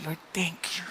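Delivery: breathless whispered thank-you to God. Retold or imitated. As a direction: whisper it, grateful and hushed. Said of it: "Sabi ko".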